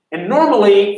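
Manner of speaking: usual speaking voice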